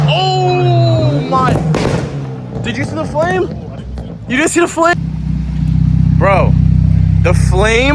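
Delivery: high, loud amazed disbelief; excited. Real, not acted. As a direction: shout it, stunned by the flame. Saw flames from the exhaust.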